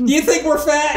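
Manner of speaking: high-pitched question bursting out after laughter; amused disbelief. Real, not acted. Host reacts to hearing Americans are fat, amused disbelief.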